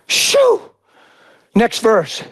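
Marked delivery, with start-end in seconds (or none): 0.1-0.6 shoo. Real, not acted. Exclamation after preaching peak.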